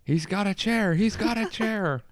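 high, restrained ringside call; mock commentator reprise. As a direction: replay the wrestling call at half volume. Reprises the wrestling commentary joke.

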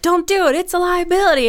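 high, loud mock warning; inner alarm. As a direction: sound the alarm inside your head. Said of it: "light bulbs start going off".

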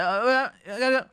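high, loud flustered stammer; stressed babbling. Acted. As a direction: act out frantic sputtering under stress. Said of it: "you become stressed".

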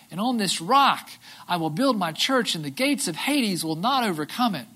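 reading the words of Jesus aloud; scripture reading. Acted. Pastor reading Jesus' words to the church, read with weight. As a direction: read it as holy words to the congregation.

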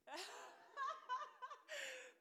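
high, drawn-out giggle; laugh. Real, not acted. Preacher laughing at her own aside, giggling. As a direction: let out a high run of laughter.

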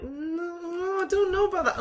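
high-pitched, drawn-out doubtful whine; exaggerated sceptical squeak. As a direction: stretch the no way up high, unconvinced. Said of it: "I was kind of doubtful".

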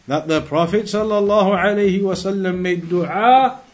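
usual speaking voice

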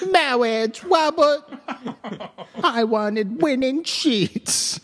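high, drawn-out mock clergyman; slow pompous high voice with comic impediment; Princess Bride priest. Voicing Gina to Robert, high mock priest voice. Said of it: "Is that still the priest from The Princess Bride?".

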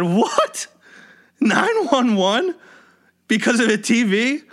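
high-pitched, stunned questioning; incredulous disbelief. Retold or imitated. Rental worker to manager about calling nine one one, incredulous. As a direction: question it in shrill-free, high disbelief, drawing out the words.

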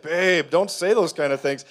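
scolding wife. Retold or imitated. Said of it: "and she's like".

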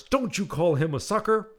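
high, loud mock scolding; protective scolding. Miriam scolding the nurse, a loud mock scolding. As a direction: defend him with mock indignation. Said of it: "Miriam says to the nurse".

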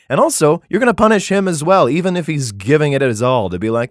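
normal talking voice

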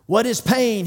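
high, loud drill question; coach's yell. Retold or imitated. Coach drilling his team at practice, yelled high. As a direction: call it out across the field. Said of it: "he yells out".

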